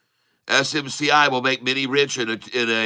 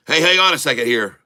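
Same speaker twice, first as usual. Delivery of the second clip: ordinary talk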